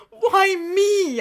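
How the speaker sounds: high, tearful wail; mock-crying, high-pitched yell; yelled plea. A narrator's reading. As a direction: wail it in melodramatic despair. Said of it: "I yell".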